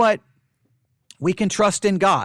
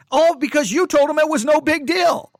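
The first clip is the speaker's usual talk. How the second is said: raised, high-pitched reproach; heated warning; 8 semitones higher, 4 dB louder than usual. Real, not acted. Warning pastors who downplay the virus, heated reproach. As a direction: drive the blame home, worked up.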